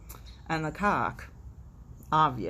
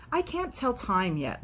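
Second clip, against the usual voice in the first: whiny chick; 8 semitones higher than usual. Acted. Chick excuses itself.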